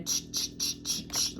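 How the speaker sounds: soft unvoiced ch-ch-ch riffle noise; whispered shuffling noise; imitated card shuffle sound. Shown as a demonstration. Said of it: "like that".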